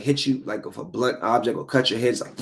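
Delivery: ordinary talk, nothing marked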